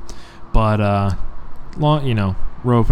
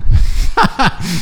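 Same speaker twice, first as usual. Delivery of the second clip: breathy laugh; 8 semitones higher than usual. Real, not acted. Laughing at the joke.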